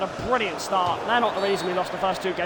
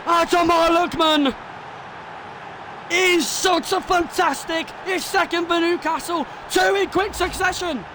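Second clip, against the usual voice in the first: much higher, louder, screaming with delight; ecstatic goal call; 12 semitones higher, 3 dB louder, slower than usual. Acted. Gamer screaming commentary at a wonder goal, ecstatic. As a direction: lose it completely at a wonder goal.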